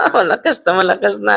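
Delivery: chuckling through the words; amused laughing. Real, not acted. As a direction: laugh while saying it. Laughs while repeating the remark.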